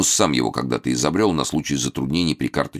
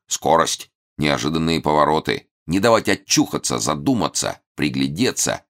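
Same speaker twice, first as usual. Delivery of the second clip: ordinary delivery, nothing marked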